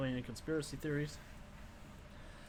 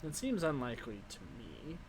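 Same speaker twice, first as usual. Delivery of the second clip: put-on wizened old crone. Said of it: "wizened old crone voice".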